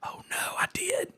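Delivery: whispered dread, breath only; a retold whisper of sudden panic; horrified whisper. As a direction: whisper it as your stomach drops. Realizing the text went to her boss.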